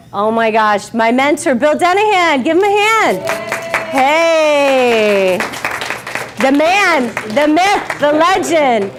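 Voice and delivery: loud, high, cheering introduction; excited hype. Real, not acted. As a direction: hype him up like a ring announcer. Cheering on her mentor Bill Denahan.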